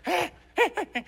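a lone ape on the shore calling out; monkey call. Retold or imitated. The ape on the shore calling back, high short grunts. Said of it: "and he's like".

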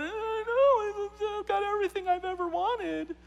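very high, wailing girl imitation; mock bawling. Rich girl wailing to youth leader, mock sobbing. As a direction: bawl it like a spoiled teen, hammed-up. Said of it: "she's just bawling".

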